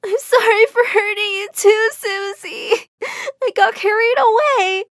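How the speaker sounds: high, tearful, sobbing apology; crying. Acted. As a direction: cry through the words, breaking voice. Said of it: "I found myself crying with her".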